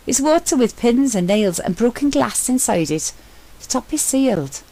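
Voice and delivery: an eager explorer calling up from a hidden chapel; Sentry's excited voice. A narrator's reading. Sentry describing the jar.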